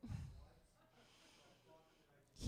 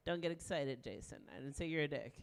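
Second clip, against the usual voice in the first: usual speaking voice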